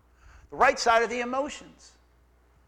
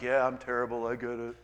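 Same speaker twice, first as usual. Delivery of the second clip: mock weary sigh; 9 semitones lower than usual. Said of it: "'cause I'm like".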